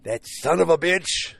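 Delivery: loud, high, drawn-out curse; outraged outburst. Real, not acted. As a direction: exclaim it slowly in indignation. Outraged at the show's reveal.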